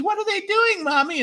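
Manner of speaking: put-on high, childlike question; squeaky kid asking mommy; little kid voice. Kids asking on whale trip.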